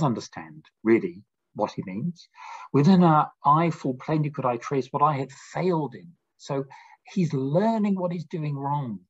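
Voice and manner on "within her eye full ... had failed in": poem reading. Acted. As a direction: read the verse aloud, a little louder and lifted.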